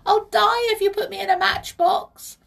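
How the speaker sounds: high, loud tiny-creature wail; distressed pixie. A narrator's reading. Pixie fears the matchbox, distressed cry. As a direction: plead desperately at the top of your pitch. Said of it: "tears fell down".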